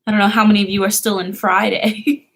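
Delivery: ordinary talk, nothing marked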